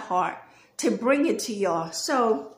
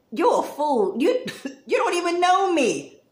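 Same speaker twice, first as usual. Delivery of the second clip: telling someone off; 6 semitones higher than usual. Retold or imitated. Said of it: "how many times I done said this".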